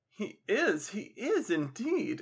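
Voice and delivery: high, drawn-out ladylike agreement; energetic exclamation. Acted. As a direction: gush it slowly in a high woman's voice. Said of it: "exclaimed Belinda Waters energetically".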